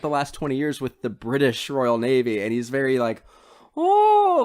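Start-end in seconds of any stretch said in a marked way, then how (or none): 3.8-4.4 high, loud, drawn-out dandy "oh". Retold or imitated. Podcaster imitating the brother back from the navy, hammed-up dandy. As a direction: put on a foppish, high-pitched dandy voice. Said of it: "very, like, dandy".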